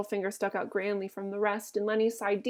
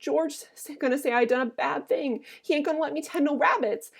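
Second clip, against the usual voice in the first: higher-pitched, fretful worry; frightened pleading; 5 semitones higher than usual. A narrator's reading. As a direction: plead in dread of punishment. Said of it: "began to cry with fright".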